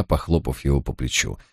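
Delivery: usual speaking voice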